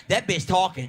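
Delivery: ordinary talk, nothing marked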